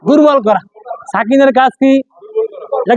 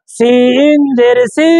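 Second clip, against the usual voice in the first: slow drill; much slower than usual. Shown as a demonstration. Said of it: "slow drilled spelling for students".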